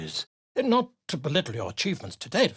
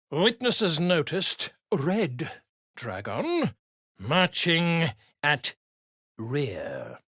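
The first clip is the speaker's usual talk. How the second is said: dictating while writing; 3 semitones lower, slower than usual. Acted. Scribe dictating as he writes.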